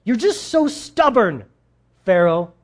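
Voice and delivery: high, loud, fed-up rant at Pharaoh; exasperated outburst. Retold or imitated. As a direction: scold him like an angry prophet who has run out of patience. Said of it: "and he's like".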